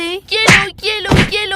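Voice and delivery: loud scolding yells; playful angry shout. Acted. Simran beating Raj with a basket, shouting. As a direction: punish him with each blow.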